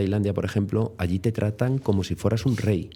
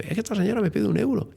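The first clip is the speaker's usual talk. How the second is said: much higher mock whiny complaint; friend complaining; 12 semitones higher, faster than usual. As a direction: whine it like the panicked friend. (amigo quejándose al narrador, voz aguda)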